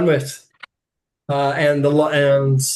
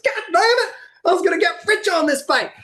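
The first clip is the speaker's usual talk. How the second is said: high-pitched mock outburst; fast, high exasperated yell; angry bettor; faster than usual. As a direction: curse like a gambler who just lost. Losing bettor throws his ticket.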